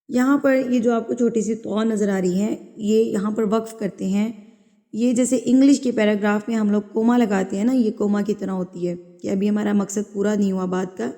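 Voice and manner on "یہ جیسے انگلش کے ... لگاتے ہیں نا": ordinary talk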